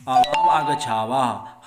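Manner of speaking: loud announcing call; calling out arrival. Acted. Mouse and crow call loudly to their trapped friend, loud call.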